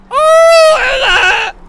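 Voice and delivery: very high, loud squealed greeting; mock shrieking woman. Mocking hot women once they start talking, shrieked. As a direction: squeal it as high as you can. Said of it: "as soon as they start talking".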